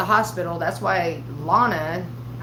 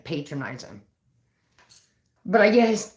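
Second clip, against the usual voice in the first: ordinary talk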